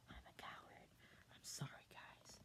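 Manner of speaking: soft whispered aside. Real, not acted. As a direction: whisper it, ashamed. Whispered side comment.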